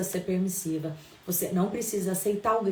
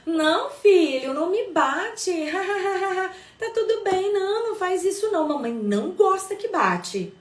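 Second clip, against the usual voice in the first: much higher, louder, pleading and giggly; mock permissive mother; 10 semitones higher, 4 dB louder than usual. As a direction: play the pushover mom who can't say no. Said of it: "Imagina eu".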